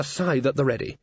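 ordinary delivery

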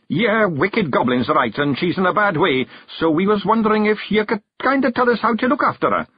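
high-pitched fairy character voice; fairy asking for help. A narrator's reading. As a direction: plead your case in a high little voice. Story figure asking for help.

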